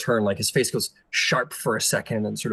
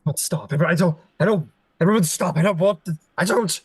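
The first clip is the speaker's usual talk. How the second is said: panicked prince; 6 semitones higher than usual. Acted. Prince overwhelmed by the sisters, rising panic. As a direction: pitch climbing higher with each plea. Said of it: "He goes".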